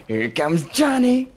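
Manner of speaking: high, drawn-out movie call; hammed-up holler. Quoting a film villain at the door, high slow holler. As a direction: stretch it out, rising high on the name. Acting out a famous film line.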